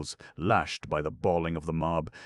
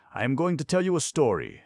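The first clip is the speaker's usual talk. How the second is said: bright storyteller; 10 semitones higher, 5 dB louder, faster than usual. A narrator's reading. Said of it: "said Maria".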